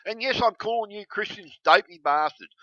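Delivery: normal talking voice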